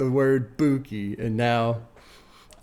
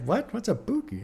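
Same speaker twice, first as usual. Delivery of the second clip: higher, softer mock confused wife; imitating wife puzzled; 9 semitones higher, 4 dB quieter than usual. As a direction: play the baffled wife asking what a Buki is. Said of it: "she's like".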